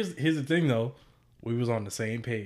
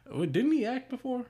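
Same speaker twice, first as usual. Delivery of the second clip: ordinary talk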